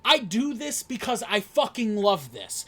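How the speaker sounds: losing it at the rival, full-force shout; furious yell. Real, not acted. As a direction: scream it like you mean every word. Wrestler yelling during heated promo.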